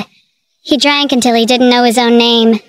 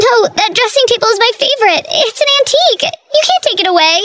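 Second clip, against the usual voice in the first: high, fast, frantic pleading; high panicked protest over her things; frantic cry; 10 semitones higher, faster than usual. Acted. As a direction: wail at the movers to stop. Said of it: "she cried".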